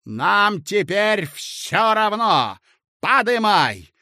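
loud, slow devil-may-care order; nothing to lose now; reckless Korotkov. Acted.

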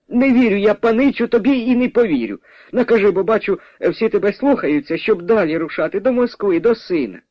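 high, insistent peasant mother; disbelieving mother. Acted. Mother refuses to believe the gentleman.

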